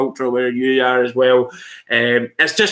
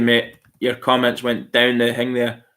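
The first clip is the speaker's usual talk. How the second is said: ordinary talk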